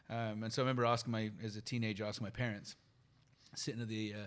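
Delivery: ordinary talk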